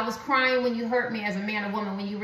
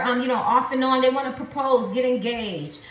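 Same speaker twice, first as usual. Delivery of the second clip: usual speaking voice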